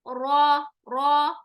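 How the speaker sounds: रॉ with a dark rounded heavy o; heavy tafkheem ra; heavy Arabic ra. Shown as a demonstration. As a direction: say रॉ with weight in the mouth. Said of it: "आवाज में भारीपन होना चाहिए".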